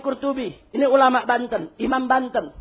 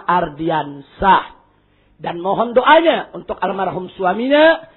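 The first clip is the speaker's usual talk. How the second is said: lower, slow, drawn-out phrasing; solemn prayer announcement; 6 semitones lower, slower than usual. Real, not acted. Preacher asks prayers for the late and for a child, solemn announcement. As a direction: read out prayer intentions with long pauses. Leads prayer requests for congregants.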